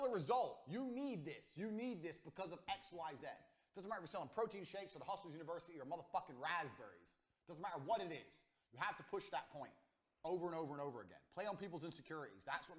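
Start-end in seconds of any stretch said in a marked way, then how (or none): none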